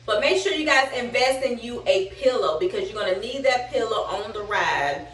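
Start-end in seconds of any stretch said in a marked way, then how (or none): none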